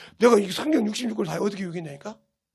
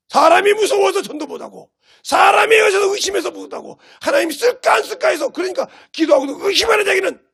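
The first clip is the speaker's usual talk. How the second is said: high, loud pulpit shouting; preaching at full force; 9 semitones higher, 6 dB louder than usual. Real, not acted. Preacher berates believers who fear and doubt, full-force shouting. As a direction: hammer each failing home at a shout.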